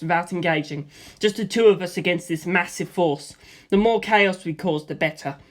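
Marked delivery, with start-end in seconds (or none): none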